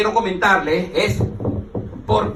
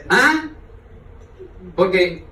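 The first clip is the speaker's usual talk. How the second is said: ordinary talk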